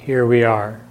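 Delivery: unhurried meditation voice with long silences between phrases; calm, spacious delivery, leaving room to breathe; guided meditation. Acted. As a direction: gently guide listeners inward, phrase by phrase.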